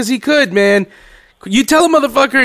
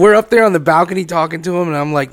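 usual speaking voice

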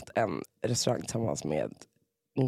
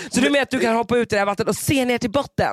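ordinary delivery

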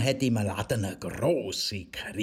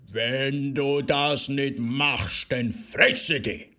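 slow, louder menacing growl; growling threat; 4 semitones higher, 5 dB louder, much slower than usual. Acted. Wolf threatening the miller, slow loud growl. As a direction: threaten him slowly and loud. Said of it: "mit böser Stimme knurrt".

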